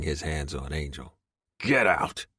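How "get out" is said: a mild bark of an order; mock barked demand at the beaten man. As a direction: snap it out, lightly raised. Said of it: "I bark".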